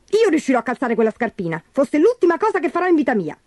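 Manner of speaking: high, defiant squeal; rising to a very high pitch; shrieking stepsister. A narrator's reading. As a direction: vow it stubbornly in a screechy put-on voice. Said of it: "strillò Gertrude".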